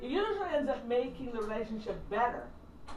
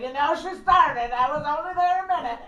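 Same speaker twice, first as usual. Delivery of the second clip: whiny gossip; 3 semitones higher, 8 dB louder than usual. Retold or imitated. Daughter calling her sister to complain about mom, in a whiny voice. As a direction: complain like a tattling child. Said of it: "calling your sister, like".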